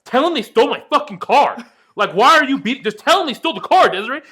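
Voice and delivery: very high, loud exasperated shouting; frantic yelling. Retold or imitated. Owner yelling at girlfriend to tell his dad, frantic shout. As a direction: yell at her in frustration.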